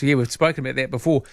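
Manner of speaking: ordinary talk, nothing marked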